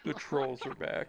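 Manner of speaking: slow, low lazy drawl; soft brooding e-boy voice; [name] voice. Retold or imitated. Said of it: "Corpse husband voice".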